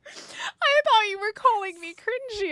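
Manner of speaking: high, cracking laugh-through confession; laughing relief. Real, not acted. Streamer laughing at misreading chat's comment, high and cracking.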